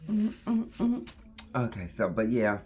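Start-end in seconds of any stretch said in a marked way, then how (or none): 0.1-1.1 high cooing to a puppy; doting mommy voice. Real, not acted.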